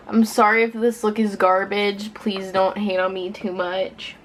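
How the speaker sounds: normal talking voice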